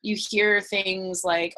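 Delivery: ordinary talk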